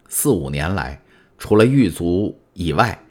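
ordinary delivery

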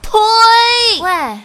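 very high, very loud scream; full scream. Acted. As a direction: scream at the top of your lungs. Final shouting match. (声嘶力竭地尖叫)

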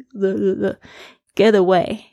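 "get away" with the t flapped, halfway between t and d; "get away" t like a quick d; flapped t demo. Shown as a demonstration. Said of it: "也不是完全D的声音，也不是完全T的声音".

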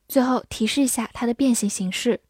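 usual speaking voice

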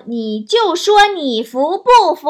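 slow, loud, pitch up; mock boastful shout. Mother boasting about her daughter, mock shout. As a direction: challenge them to admit it, half-shouted. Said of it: "还要夸着我说".

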